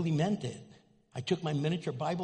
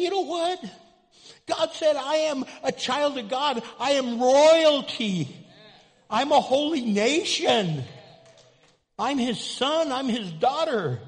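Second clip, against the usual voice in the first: loud, high declaration, royalty drawn out; preaching; 8 semitones higher, 8 dB louder than usual. Acted. Pastor leading the church in proud affirmation, loud and fervent. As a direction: proclaim it boldly.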